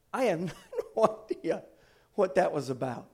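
laughing through the words; amused laugh at his own oddness. Real, not acted. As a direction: chuckle through it, bemused. Laughs at himself.

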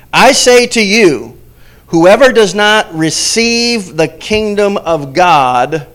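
slow, loud, high and emphatic; forceful proclamation. Acted. Preacher reading Jesus' stern warning, slow and loud. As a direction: proclaim it like scripture thundered.

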